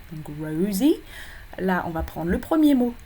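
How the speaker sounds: usual speaking voice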